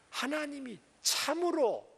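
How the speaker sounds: 참으로 lifted high and drawn out; stress on 참으로. Shown as a demonstration. Preacher showing the serpent's hook on 참으로. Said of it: "물음표를 딱 하면".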